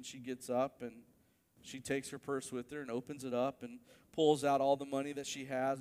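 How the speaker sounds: ordinary talk, nothing marked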